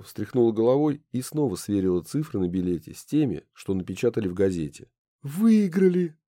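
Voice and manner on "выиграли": high, surprised cry. Acted. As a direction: gasp it out, amazed. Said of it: "сказала она".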